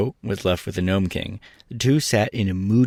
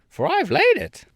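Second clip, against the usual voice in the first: hen voice. A narrator's reading. Billina voiced.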